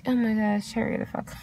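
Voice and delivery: exasperated whisper; whispered under breath. Retold or imitated. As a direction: whisper it, annoyed. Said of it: "I'm just like".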